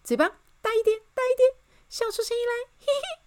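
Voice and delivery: very high, quick squeaky encouragement; cheerful coaching. A narrator's reading. Miss Optimist teaches the king laughing, high and eager. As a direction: urge him on, ending in giggles.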